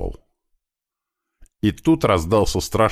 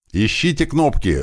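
urgent, high-pitched acted yell; yelled order; 8 semitones higher than usual. Leader to his men under fire, high-pitched yell. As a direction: send them searching with a raised voice. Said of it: "заорал я".

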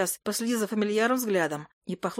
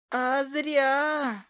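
high, put-on spooky voice; ominous familiar, pitched up; scary familiar voice; 6 semitones higher than usual. A narrator's reading. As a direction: say it in a mock-frightening creature voice. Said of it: "пробасила Файка страшным голосом".

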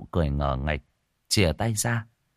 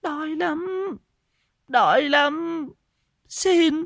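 very high, slow pleading wail; wailing hunger; much slower than usual. A narrator's reading. Starving madwoman wails to the old woman for food, very high. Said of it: "gào lên".

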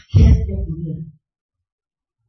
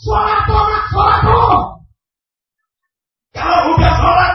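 loud, high exhortation; insistent preacher's shout; preaching at full force; 12 semitones higher, 8 dB louder than usual. Real, not acted. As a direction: hammer the words out, loud and high.

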